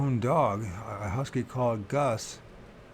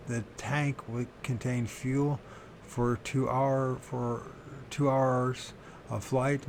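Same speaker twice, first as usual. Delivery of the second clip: ordinary talk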